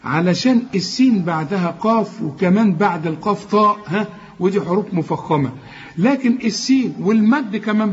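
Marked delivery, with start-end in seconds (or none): none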